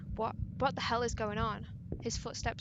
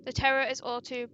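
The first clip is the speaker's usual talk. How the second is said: plain reading voice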